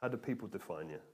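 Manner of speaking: low, soft and inward; a hushed, searching repeat; quiet reflective question. Shown as a demonstration. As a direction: ask it softly, as if to yourself. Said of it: "It's a question to ask yourself".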